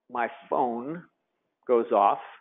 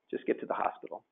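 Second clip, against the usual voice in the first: breathy whisper of "Just get to the hospital"; whispered repeat; 10 dB quieter than usual. Retold or imitated. Husband repeating to wife on phone, whispered.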